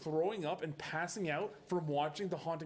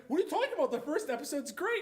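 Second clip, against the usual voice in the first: high, louder mock protest; indignant fans; 9 semitones higher, 3 dB louder, faster than usual. Mocking people who defend the first episode, indignant. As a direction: imitate everyone gushing in disbelief. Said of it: "everyone's like".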